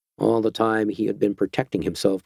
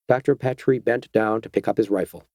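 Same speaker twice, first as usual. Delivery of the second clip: ordinary delivery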